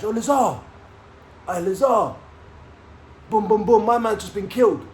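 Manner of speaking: high, loud re-enacted yell; imitated shout. Inmate shouting bad news to Lazar, re-enacted shout. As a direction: call out urgently to someone far off. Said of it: "someone shouts".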